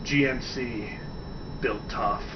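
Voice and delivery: low, soft announcer voice for GMC built tough; ad slogan. Retold or imitated. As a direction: deliver it like a deep truck commercial tagline. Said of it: "I would love to be like".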